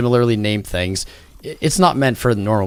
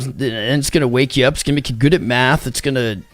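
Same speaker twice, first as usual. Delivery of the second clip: higher, excited bro voice pitching a drug; mock stoner bro; 3 semitones higher than usual. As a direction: hype it up like an eager friend. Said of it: "and I'm like".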